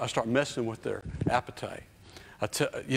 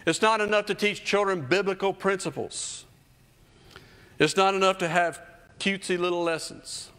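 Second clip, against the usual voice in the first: raised preaching voice, higher; 6 semitones higher than usual. Acted. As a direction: preach it, driving the point home. Preaching at full force.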